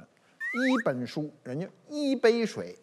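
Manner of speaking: 一 in 一本书 and 一杯水 kept on a level first tone, very slow; foreigner's Chinese. Retold or imitated. Teacher imitating a foreigner saying 一杯水, slow and level. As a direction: lightly mimic a foreign learner missing the tone change of 一. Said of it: "你看这不是外国人说话吗".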